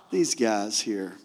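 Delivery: low, slow, quiet exasperation; mock weary aside about hopeless friends; exasperated aside. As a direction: drop it low and slow, half to yourself. Jesus' weary aside about clueless disciples.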